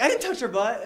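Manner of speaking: indignant denial. Retold or imitated. Denying accusation to principal.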